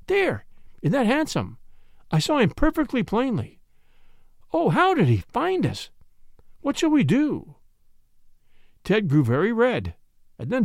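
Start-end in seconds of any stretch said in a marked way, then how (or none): 0.1-7.6 high-pitched, startled urgency; gasped alarm. A narrator's reading. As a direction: exclaim it in rising alarm. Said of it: "she gasped".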